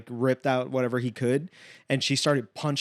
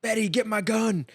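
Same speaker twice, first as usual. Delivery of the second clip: mock calling out; 8 semitones higher than usual. Homeowner calling his wife for help, mock urgency. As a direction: call urgently to someone in another room, imitated.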